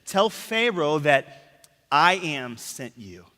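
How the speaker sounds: slow and measured; slow voice of God. Retold or imitated. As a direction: lay out God's words slowly. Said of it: "He says".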